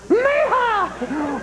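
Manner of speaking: high, gleeful cowboy holler; rowdy whoop. Acted. Clinton thinks of the bachelor party, rowdy whoop. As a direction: let out a party yeehaw.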